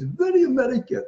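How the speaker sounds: lightly mock the Parisian doctor; imitating the Paris friend. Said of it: "And he says".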